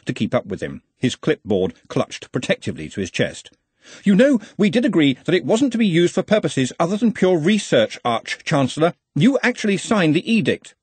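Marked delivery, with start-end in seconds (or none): 4.0-10.7 high-pitched, insistent subordinate; earnest, fussy assistant. Acted. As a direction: remind your superior of the rules in a higher voice. Ponder reminds his Arch-Chancellor.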